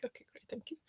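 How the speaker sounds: dry mock thank-you, breathed out under the breath; sarcastic whispered thanks. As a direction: whisper a sarcastic okay great. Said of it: "I'm like".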